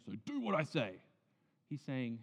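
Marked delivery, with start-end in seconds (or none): 0.0-1.0 mock bossy God. Shown as a demonstration. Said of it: "He's not saying".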